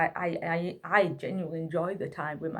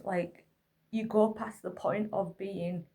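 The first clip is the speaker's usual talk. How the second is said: usual speaking voice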